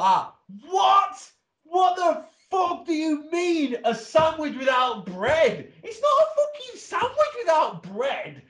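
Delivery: furious squeal, half laughing; outraged rant. Retold or imitated. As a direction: blow up at the idea of a breadless sandwich. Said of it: "but I kicked off".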